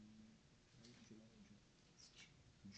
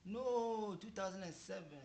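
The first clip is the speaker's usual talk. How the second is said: loud correction; 8 semitones higher, 14 dB louder than usual. Real, not acted. Husband corrects his own answer.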